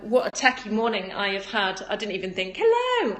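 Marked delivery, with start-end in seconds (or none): 2.6-3.2 squealed greeting; bright high hello. Real, not acted. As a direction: pop up to greet a friend. Greeting a viewer mid-sentence.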